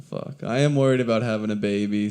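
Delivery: usual speaking voice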